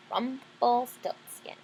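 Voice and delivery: Rumpelstiltskin drawn out slowly syllable by syllable; slow model name. Shown as a demonstration. Teacher models Rumpelstiltskin slowly for children to repeat. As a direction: sound out Rumpelstiltskin slowly for the kids. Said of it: "Can you say that?".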